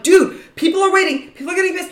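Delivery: high, loud, urgent complaint; exasperated retold scolding. Girlfriend telling boyfriend off for blocking shoppers, exasperated. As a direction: imitate yourself urgently scolding a partner. Retelling her rebuke of boyfriend.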